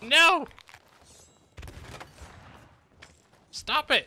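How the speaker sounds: loud, high panicked yell; shouting no. Real, not acted. Player yells at the enemy crew, loud and panicked. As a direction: yell at them to stop. Enemy attacking his ship.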